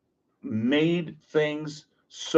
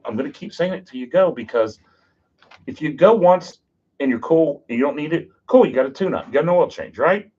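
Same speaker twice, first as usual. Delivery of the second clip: ordinary talk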